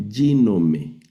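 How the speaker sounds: "ginome" with "gi" stressed and the rest falling; stress on first syllable. Shown as a demonstration. Teacher showing the accent in the first syllable, stressed "gi". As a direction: hit "gi" in "ginome", let "nome" drop. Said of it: "el acento en la primera sílaba".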